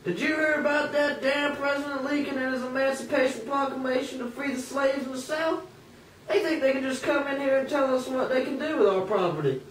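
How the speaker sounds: high, indignant master voice; a hint of a resentful master. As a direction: grumble about Lincoln like a planter. Master complaining about Lincoln.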